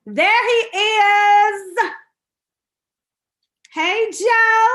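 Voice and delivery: big, sing-out welcome; excited greeting. Real, not acted. Host hails her guest as he joins the show, excited greeting. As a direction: call it out high and loud, drawn out.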